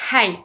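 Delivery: "Hi" with a big puff of breath; exaggerated aspirated H. Shown as a demonstration. Teacher exaggerating to show learners, "Hi" with a heavily breathed H. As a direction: push air out on the H of "Hi". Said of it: "J'exagère pour vous montrer".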